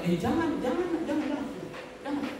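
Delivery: retold high-pitched, hurried protest; urgent refusal. Father stopping son Jimmy from going, urgent retold refusal. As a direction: act out stopping someone, repeated and high.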